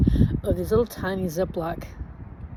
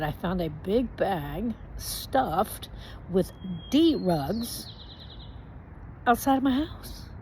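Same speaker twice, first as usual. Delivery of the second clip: very slow, spelled-out repeat; each word dragged out to be understood; slow, deliberate repeat; 3 dB quieter, much slower than usual. Retold or imitated. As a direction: say it again slowly for the cop. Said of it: "And I said".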